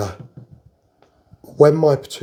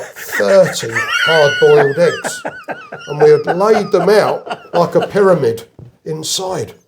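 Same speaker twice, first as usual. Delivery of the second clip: laughing through the words, louder and higher; 8 semitones higher, 6 dB louder, slower than usual. Real, not acted. Telling a friend about the egg prank, laughing. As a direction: chuckle as the prank comes out.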